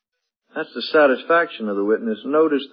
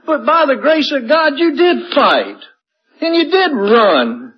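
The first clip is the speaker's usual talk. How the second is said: tearful climax; 12 semitones higher, 6 dB louder than usual. Acted. Preacher tells the congregation they did fight, near tears. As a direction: drive home each "did", almost crying.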